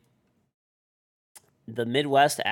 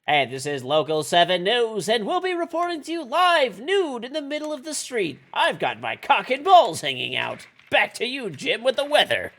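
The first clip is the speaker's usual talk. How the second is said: hammed-up high, loud anchor voice; newscaster parody; 11 semitones higher, 6 dB louder than usual. Streamer mocking a local news anchor, hammed-up. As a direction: play a cheesy local TV anchor. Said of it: "doing the".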